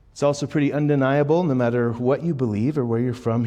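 usual speaking voice

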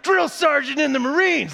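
high, loud barking yell; a sweet instructor suddenly turned screaming sergeant; drill sergeant yell. Retold or imitated. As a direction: bellow it like a Marine drill sergeant. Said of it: "she's just screaming her head off".

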